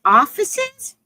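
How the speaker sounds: high rising startled "Officers?"; alarmed question. Retold or imitated. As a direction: caught off guard by the police. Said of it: "She goes".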